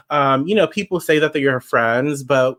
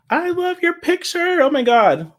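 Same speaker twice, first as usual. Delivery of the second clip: much higher, thrilled reaction to a photo; delighted gush. Real, not acted. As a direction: squeal over a viewer's cute picture. Reacting to a viewer's picture.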